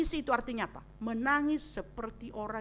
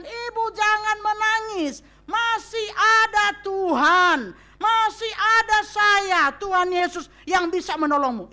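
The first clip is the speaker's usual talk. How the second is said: Jesus reassuring the widow; 7 semitones higher, 9 dB louder, slower than usual. Retold or imitated.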